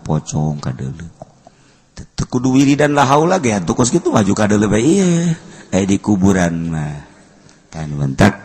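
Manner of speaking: ordinary talk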